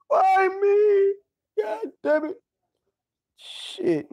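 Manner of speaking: high, loud crying shout at the sky; mock anguished wail. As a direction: wail at God in hammed-up despair. Cursing God after storm loss.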